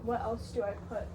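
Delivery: ordinary talk, nothing marked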